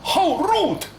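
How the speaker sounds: high, affronted exclamation; indignant. Acted. Insulted by the old woman.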